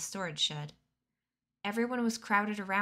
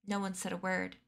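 ordinary delivery

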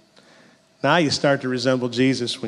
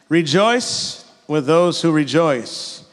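scripture reading; 4 dB louder, much slower than usual. Shown as a demonstration. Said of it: "And then verse fifteen".

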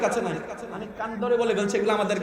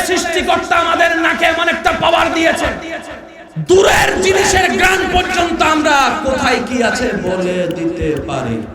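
loud, higher shouted story voices; preaching at full force; 5 semitones higher, 7 dB louder than usual. Acted. Preacher shouting the ant story to crowd.

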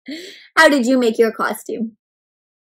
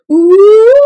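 long, high, loud "ooh" rising; ghost wail; 9 semitones higher, 13 dB louder than usual. Shown as a demonstration. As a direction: howl like a storybook ghost. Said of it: "can you make the ghost sound".

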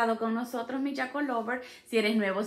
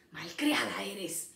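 normal talking voice

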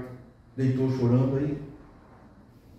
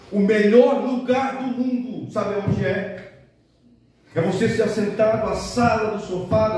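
fervent preaching; 8 semitones higher than usual. Acted. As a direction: preach it with rising fervour. Preaching at full force.

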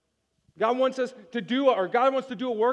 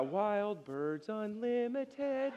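mock commercial voice; 8 dB quieter, much slower than usual. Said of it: "about as punk rock as".

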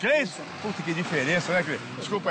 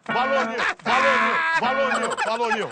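loud, teasing call across the street; 3 semitones higher, 5 dB louder than usual. Acted. Prankster teasing a stranger, loud call. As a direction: shout the name after him, laughing. Prankster calls out name loudly.

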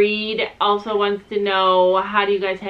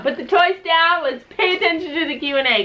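stern mom; 7 semitones higher than usual. Real, not acted. Mom scolding kids mid-video.

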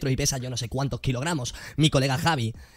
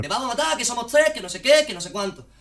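mock threat; 7 semitones higher than usual. Mocking the guy's threats.

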